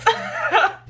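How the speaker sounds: short high-pitched laugh. Real, not acted. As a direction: laugh at your own joke. Laugh after joke.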